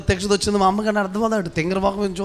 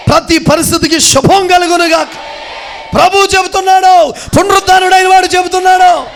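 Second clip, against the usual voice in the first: high, loud proclamation building to a shout; full-force preaching; 7 semitones higher, 9 dB louder than usual. Acted. Preacher proclaiming blessing over the congregation, full-force shouting. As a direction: preach it at the top of your voice, stretching the key words.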